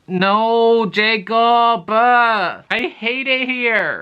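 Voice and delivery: very high, loud dismayed whine; exasperated wail. Real, not acted. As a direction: cry out in protest, stretching the words.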